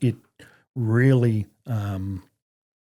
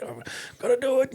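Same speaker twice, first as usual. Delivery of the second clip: much higher, pushed-up voice; strained self-urging; 13 semitones higher, 4 dB quieter than usual. Retold or imitated. Older soldier pushing himself to keep up, strained. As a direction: imitate yourself forcing one more effort, high-pitched.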